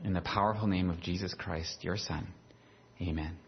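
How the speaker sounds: soft, hushed prayer to God; quiet prayer. Real, not acted. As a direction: pray quietly and reverently. Pastor prays before the sermon.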